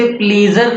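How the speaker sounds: "प्लीजर" with long ee and hard final r; wrong pronunciation. Shown as a demonstration. Teacher warning learners off a misreading, wrong pronunciation "प्लीजर". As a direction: misread pleasure as "प्लीजर", PLEE-zer. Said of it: "इसे प्लीजर नहीं पढ़ना है".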